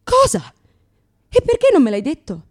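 high, loud outraged question; mock outrage; shocked. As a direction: burst out in disbelief. Shocked reaction.

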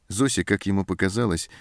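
ordinary delivery, nothing marked

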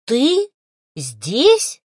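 loud, astonished question; amazed. A narrator's reading. Woman sees her partner's ghost, amazed. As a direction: ask it word by word in disbelief. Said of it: "так изумилась".

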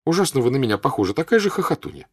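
loud, quick teasing; playful scolding girl. Acted. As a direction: daughter teasing her giggling mother. Daughter teasing laughing mother.

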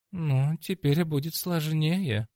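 character voice. Acted. Cornerman assesses the transformed opponent, slow character voice. Максвелл reacts to grown demon.

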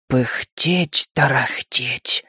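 soft slow rustling voice; rustling whisper-like voice. Acted. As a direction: rustle the words out softly. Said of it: "прошелестел Сахарок".

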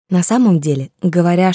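usual speaking voice

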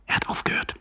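soft whispered warning. Acted. Young man warns neighbour the coughing has stopped, whispering. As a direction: whisper it cautiously.

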